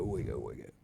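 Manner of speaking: mock sinister echo; deep spooky wicked. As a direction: drop into a deep ominous murmur. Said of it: "I bet you felt wicked out there".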